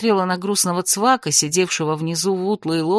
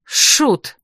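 short, higher snort of dismissal; snorting retort; 5 semitones higher, 4 dB louder than usual. A narrator's reading. Heroine scoffs at the wizard in the boat, snorting. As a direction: snort the word out. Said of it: "фыркнула я".

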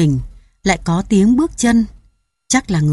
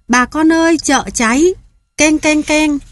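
shouting alarm; 9 semitones higher than usual. A narrator's reading. (hô hoán thất thanh)

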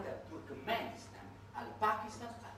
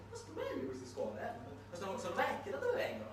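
mock interviewer; 6 semitones higher than usual. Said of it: "la BBC intervista ... e gli chiede".

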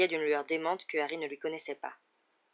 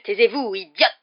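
loud, raised snap; furious outburst; 4 semitones higher, 12 dB louder than usual. A narrator's reading. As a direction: cut them off with a sudden yell. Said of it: "s'écria Rogue qui avait soudain l'air d'un dément". (cri furieux et soudain)

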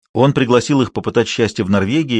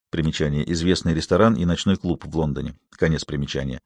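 quieter, lower footnote reading; footnote aside; 6 semitones lower, 6 dB quieter than usual. A narrator's reading. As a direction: step out of the story to read a note. Footnote read by narrator. (тихое чтение примечания)

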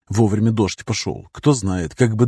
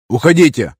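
shouting; 5 semitones higher, 6 dB louder than usual. Acted. Andrey shouts at strangers to leave.

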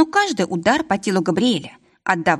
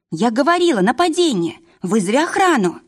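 panicked; 5 semitones higher than usual. Acted. Varvara panicking.

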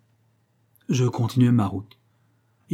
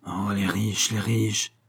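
seething rage; 3 dB quieter, slower than usual. A narrator's reading. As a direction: rage under your breath. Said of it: "Il s'agitait avec rage". (rage sourde et contenue)

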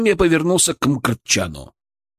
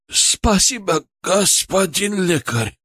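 raspy, higher, slow thanks; hoarse gratitude; 7 semitones higher, slower than usual. Acted. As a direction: croak out reverent thanks. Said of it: "прохрипел он".

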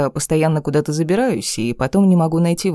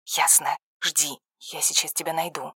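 much softer, calm and brief; hushed; 9 dB quieter than usual. A narrator's reading. Подруга тихо по телефону. (тихо и коротко)

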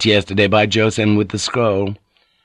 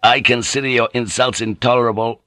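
plain reading voice

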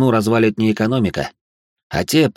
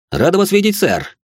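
eager waiter; 10 semitones higher than usual. Acted. Maître d' greets foreign guest.

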